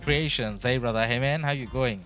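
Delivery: ordinary delivery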